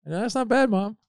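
very high, teasing praise; playful mock admiration; mock impressed. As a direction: tease mom with exaggerated approval.

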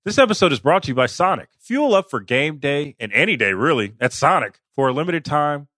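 upbeat sponsor read. Acted. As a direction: sell the tender wraps cheerfully. Host reads a sponsor ad.

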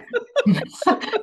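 high, bright burst of laughter. Real, not acted.

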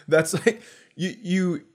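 ordinary delivery

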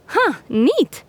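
put-on high, impressed girl; lightly voiced, impressed girl. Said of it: "said Nadia".

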